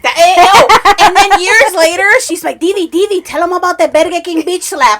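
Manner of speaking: excited retelling. Real, not acted. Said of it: "she was so proud".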